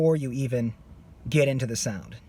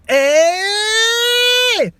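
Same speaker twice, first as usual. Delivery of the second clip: high pinched screamed eh; tiny tight scream; small scream; 7 dB louder than usual. Shown as a demonstration. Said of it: "keep it on a really, really small".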